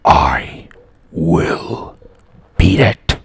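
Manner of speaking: loud, voiceless menacing rasp; forceful stage whisper, word by word; creepy. Acted. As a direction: rasp each word separately like a threat. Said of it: "creeped out yet?".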